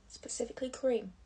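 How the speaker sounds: cream lightly stressed, falling tune. Shown as a demonstration. Vlogger repeating for her viewers, light stress on cream. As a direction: give the word cream a little extra weight. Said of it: "Has to emphasize the word cream".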